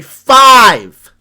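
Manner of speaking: FIVE shouted out, drawn long; emphatic shout. Real, not acted. Reactor stunned by the number of trillionaires, emphatic shout. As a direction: hit "five" hard and loud.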